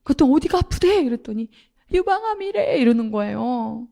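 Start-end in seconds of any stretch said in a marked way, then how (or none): 0.3-1.0 anxious question. Retold or imitated. Anxiously asking brother on phone. 1.9-2.8 much higher, broken mimic of a brother near tears; a hint of the crying brother's wail; imitating devastated brother. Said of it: "거의 죽어가는 거야".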